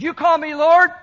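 loud, high proclamation; emphatic declaration. Acted. Jesus' claim voiced emphatically against false modesty.

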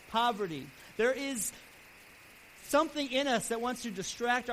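preaching. Acted. Preacher urging his flock, with full-voiced exhortation. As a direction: press the point home to the congregation.

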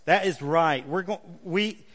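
ordinary delivery

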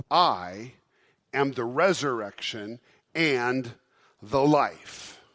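loud, slow, every word weighed; a declaration hurled out to the crowd; booming proclamation. Acted. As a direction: proclaim it to the whole room. Preacher booms Jesus' claim.

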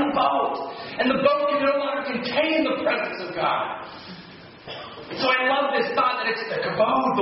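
rising, impassioned sermon voice; fervent preaching. Acted. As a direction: preach it with mounting fervor. Preaching climax, pitch raised.

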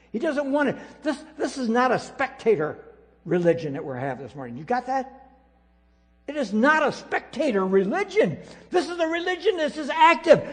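loud, high, driving sermon climax; impassioned pulpit exhortation; full-force preaching. Acted. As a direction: preach it like a call to action.

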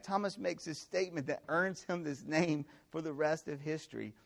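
ordinary talk

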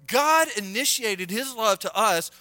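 loud, high-pitched preacher's proclamation; forceful preaching. Real, not acted.

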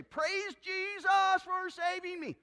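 high, loud, drawn-out hallelujah cry; mock fervent praise. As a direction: imitate a churchgoer praising loudly and slowly. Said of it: "They've heard you say".

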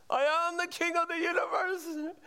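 hammed-up deluded king shout; madman screaming. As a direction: mock-scream like a deluded man on a chair. [name] repeats madman claim.

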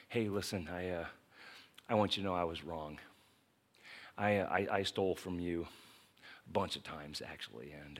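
humble man asking forgiveness at the door; humble apology. Retold or imitated. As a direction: say sorry quietly, ashamed.

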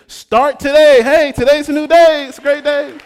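loud, high exhortation, rising with joy; preaching shout. Real, not acted. Preacher urging the congregation to start fresh, loud and high. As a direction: lift the whole room up on it.

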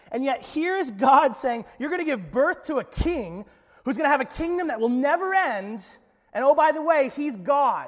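loud, high, fervent preaching; preaching at full force. Acted. Preacher stirs the church with a loud, rising plea. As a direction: hammer the absurdity home to the congregation. Preacher builds to passionate climax.

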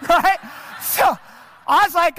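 high, loud laughing question. Real, not acted. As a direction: laugh out loud, then ask. Laughing at his lookalike.

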